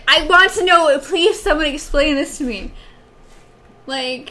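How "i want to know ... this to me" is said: louder, higher, insistent; worked-up, raised-voice appeal; exasperated plea. Real, not acted. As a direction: demand an explanation in playful frustration. Pleading for explanation of the insult.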